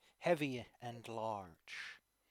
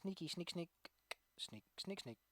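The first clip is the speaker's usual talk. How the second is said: sneaky whisper; 3 semitones higher, 9 dB quieter than usual. Acted. GM voices sneaking enemy.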